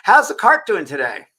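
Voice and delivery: high, loud, slowed sing-out to the nurses; playful calling out. Retold or imitated. Man hailing nurses at the food cart, playful calling out. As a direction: call it out loudly, drawing the words out.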